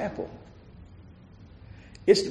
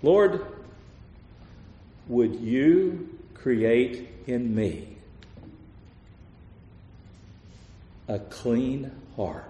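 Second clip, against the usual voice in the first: much softer and slow, a humble plea; hushed prayer; 5 semitones lower, 7 dB quieter, much slower than usual. Acted. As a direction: breathe the words out gently, begging. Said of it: "this is how he says it".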